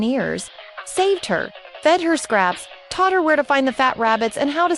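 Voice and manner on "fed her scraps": ordinary delivery, nothing marked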